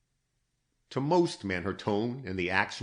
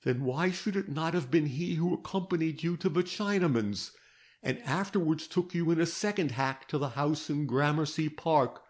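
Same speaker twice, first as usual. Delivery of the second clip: put-on lightly raised, measured voice of a stern old detective; a hint of a patient interrogator; detective Gryce; 7 semitones higher than usual. A narrator's reading. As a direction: press her gently but firmly, higher. Detective presses on.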